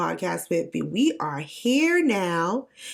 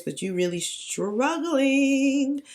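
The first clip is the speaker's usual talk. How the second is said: slow, higher, drawn-out teasing; mock sympathy; 7 semitones higher, much slower than usual. Talking to herself about her voice, slow mock pity. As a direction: drag it out pityingly. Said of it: "I'm like".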